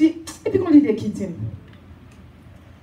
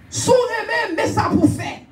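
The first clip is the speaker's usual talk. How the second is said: laughing cry; 8 semitones higher than usual. Real, not acted. Preacher repeats rallying phrase.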